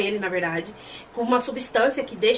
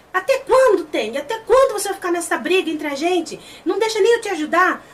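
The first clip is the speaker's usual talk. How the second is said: high, pleading mock scolding; imitated exasperation, pitched up; exasperated reproach; 5 semitones higher than usual. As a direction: act out a frustrated appeal. Acted reproach in retold scene.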